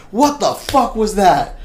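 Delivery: loud, high, slow startled shout; drawn-out, indignant yell. Retold or imitated. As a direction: demand it aloud in acted shock. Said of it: "And I say this out loud".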